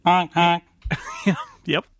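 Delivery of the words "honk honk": silly clown honks. Acted. Host echoes clown honks.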